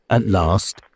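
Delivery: usual speaking voice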